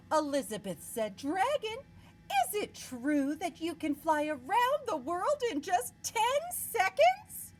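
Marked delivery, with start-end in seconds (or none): 1.2-7.5 much higher, louder at the end; high princess voice. Acted. Princess coaxing the dragon, high and eager. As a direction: sweet-talk the dragon.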